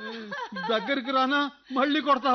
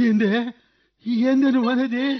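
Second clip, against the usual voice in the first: louder, stunned demand; shocked outburst; 5 dB louder than usual. Acted. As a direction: jolt of disbelief. Husband shocked by news.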